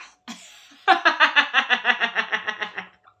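loud burst of laughter; big laugh. Real, not acted. Reacting to Genevieve, a loud long laugh. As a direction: crack up loudly.

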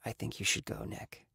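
hushed, very soft dismissal; subdued, barely voiced request to leave; quietly. A narrator's reading. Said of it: "looked down and quietly said".

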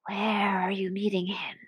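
soft whispered snake voice; hushed, breath-only snake. Acted. Snake's lines in a story.

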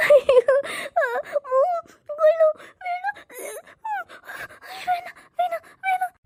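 very high frantic crying wails; choking panicked cries. Acted. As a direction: choke and wail as it is forced on you. Drink forced down her throat. (மூச்சுத்திணறி அழுது அலறுதல்)

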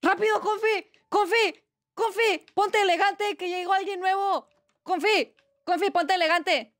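high-pitched playful panic; urgent calling out. Pretend. Streamer rallies Confi because someone new arrived, mock-urgent.

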